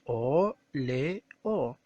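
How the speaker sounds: "óleo" pulled apart into o, le, o; syllable by syllable. Shown as a demonstration. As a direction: sound out "o, le, o" as three separate beats. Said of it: "separamos en sílabas".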